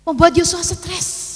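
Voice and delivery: high, very drawn-out delivery; emphatic repeat. Real, not acted. Repeats the phrase for effect. (penekanan bernada tinggi, diulur)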